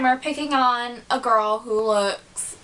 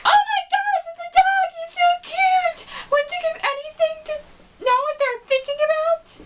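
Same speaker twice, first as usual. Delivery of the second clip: squealing over puppy. Retold or imitated. Fluttershy sees the cute dog.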